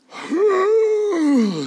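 long, high groggy wail, falling at the end; hammed-up exasperated wake-up noise; loud waking groan. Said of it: "I think he literally went".

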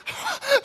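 put-on high squealing whine like a sulking kid; mock whiny squeal. Player whining to the coach on the sideline, squealing. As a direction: squeal like a kid who lost the call.

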